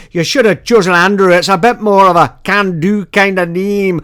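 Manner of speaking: a hint of a stern Scots dad scolding; Scotsman father. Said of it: "He said".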